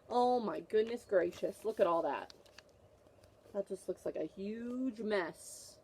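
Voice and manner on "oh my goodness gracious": ordinary delivery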